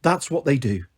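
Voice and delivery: low, soft resigned reply; with a sigh. A narrator's reading. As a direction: let it drop like a sigh. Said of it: "said Isla with a sigh".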